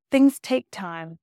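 "Things take time" said slowly and deliberately; slow repeat. Real, not acted. Slowed repeat of her phrase.

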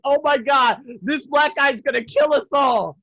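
hammed-up dread; mock terrified. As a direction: imitate a couple scared for their lives. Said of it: "They had the most terrifying look".

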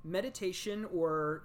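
usual speaking voice

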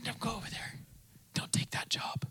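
whispered inner guidance. Retold or imitated. Preacher whispering the Spirit's leading to the congregation. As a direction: breathe it like a still small voice.

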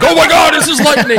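high, loud awestruck golfers; hammed-up astonished shout; mock amazement. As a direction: cry out in exaggerated wonder. Mocking amazed golfers on slow greens.

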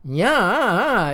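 a sharp comic Nya yelp; Curly's nya. A narrator's reading. Curly reacts to the contents with a sharp yelp. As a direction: blurt it like a startled stooge. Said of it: "exclaims Curly".

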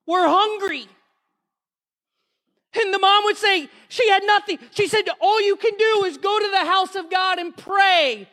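impassioned testimony preaching. Real, not acted. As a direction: preach the miracle with rising fervor.

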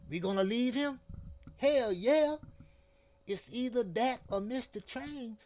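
loud, high, blunt ultimatum. A narrator's reading. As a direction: lay down the ultimatum hard. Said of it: "said Sunbolo like an ultimatum".

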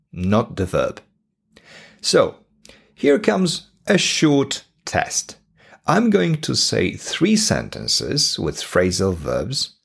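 ordinary talk, nothing marked

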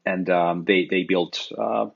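ordinary talk

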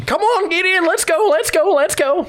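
put-on high, eager old prospector urging; old prospector hurrying them. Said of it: "an old prospector".

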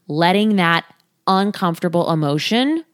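ordinary talk

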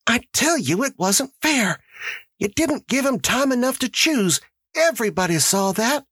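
high-pitched woman's protest; high, insistent character voice; Tessie Hutchinson protesting. A narrator's reading.

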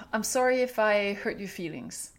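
a touch of a dismissive "sorry if"; weak apology. Shown as a demonstration. Parent to hurt child, a mild grudging sorry. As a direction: say "I'm sorry if I hurt your feelings" as a grudging brush-off. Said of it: "we tend to say".

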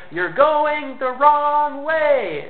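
high, drawn-out mock yell; hammed-up long-distance shout, without real volume; calling out a warning. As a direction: stretch each word like yelling between cars. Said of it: "rolls down his window and says".

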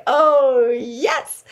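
high, loud, thrilled "oh"; delighted acceptance. Acted. Buffy accepts the squad invitation, delighted. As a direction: gush it high, swelling on the first word.

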